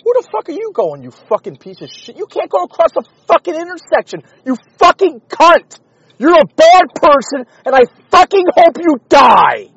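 furious high shouting at a driver; real explosive road rage, yelling; road rage screaming. Real, not acted. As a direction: scream curses at the car that cut you off.

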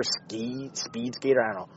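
ordinary delivery

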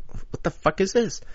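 high, soft, puzzled mutter; retold bafflement. Puzzled at the ticket machine.

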